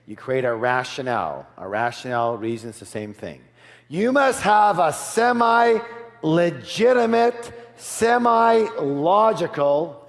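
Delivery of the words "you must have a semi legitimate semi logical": loud, slow delivery with "semi" pushed high; stress on semi. Shown as a demonstration. Speaker teaching negotiators a key rule, heavy slow emphasis on "semi". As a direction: punch "semi" in "semi-legitimate" and "semi-logical", drawing it out. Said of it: "emphasis on the word semi".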